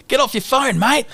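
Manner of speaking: Bluey telling her dad off for his phone; bossy child. Retold or imitated. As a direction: boss dad around like a cheeky kid. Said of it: "and it's like".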